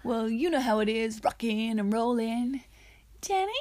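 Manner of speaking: put-on cool-guy voice; Danny acting cool. Danny posing for the boys because he's popular, put-on cool. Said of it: "I need to say things like".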